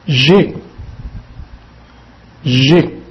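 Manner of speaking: teacher modelling J'ai slowly; French j'ai. Shown as a demonstration. Portuguese teacher drilling students in French pronunciation. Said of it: "French verb drill".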